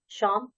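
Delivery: शाम opening on a hushing "sh"; palatal sh. Shown as a demonstration. Teacher drilling sound differences, शाम with sh as in "shut". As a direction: say शाम with a clear initial sh. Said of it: "उच्चारण करते हुए अंतर समझिए".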